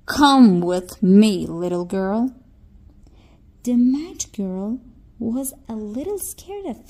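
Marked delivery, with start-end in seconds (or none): 0.1-2.3 a lightly voiced smiling fairy; gentle fairy. A narrator's reading. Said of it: "She smiled at the match girl with all sincerity".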